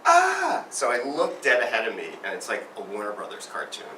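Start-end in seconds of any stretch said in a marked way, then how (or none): 0.1-0.6 sharp, much higher cry of alarm; bystander's shocked yelp; startled scream. Retold or imitated. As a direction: yelp like you see a crash coming. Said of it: "And she goes".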